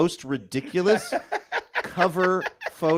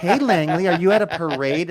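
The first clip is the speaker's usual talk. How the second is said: put-on light sing-song talk to a child; sing-songy baby voice; 4 semitones higher, 4 dB louder than usual. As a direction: coo it like talking to a toddler. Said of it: "that sing-songy baby voice".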